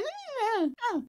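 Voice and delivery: high, soft creature squeal; small high-pitched creature noises; Bibo's babble. Retold or imitated. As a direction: imitate a little creature's squeaky language. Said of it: "E o Bibo faz".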